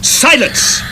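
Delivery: sharp, high shouted order; commanding shout. Acted. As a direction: cut him off with a barked command. Orders captive to stop chanting.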